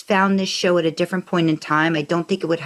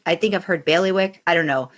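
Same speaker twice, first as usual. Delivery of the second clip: usual speaking voice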